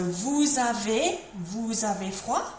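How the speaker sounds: slow model question, lifting "avez" and "froid"; statement made a question by rising on "froid"; rising intonation. Shown as a demonstration. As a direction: stretch it out, pitch climbing on "avez" then "froid". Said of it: "the statement with the rising intonation".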